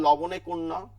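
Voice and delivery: loud quizzing লবণে কোন ন; teacher quizzing students loudly; mock quiz question. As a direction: fire the question like an examiner. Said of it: "যদি জিজ্ঞেস করা হয়".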